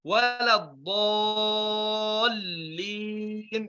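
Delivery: very long drawn waladdallin; Quran recitation. Shown as a demonstration. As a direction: hold the madd out long. Recites the verse.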